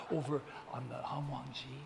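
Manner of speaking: very soft, as if gossiping; quiet mock-puzzled aside; hushed retold gossip. Retelling a table's puzzled gossip.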